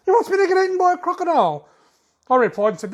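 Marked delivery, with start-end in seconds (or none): 0.1-1.7 high, fast, loud mock indignation; pitched-up fast disbelief; mock outrage. Real, not acted. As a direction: squeal it like you've been wronged. Outraged at crocodile comment.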